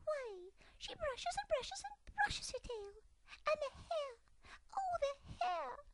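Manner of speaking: very high, rising frantic squeak; excited squeak. Acted. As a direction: work into a tizzy. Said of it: "squeaked Dimity most excitedly".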